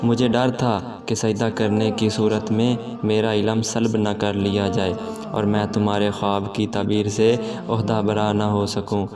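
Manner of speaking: usual speaking voice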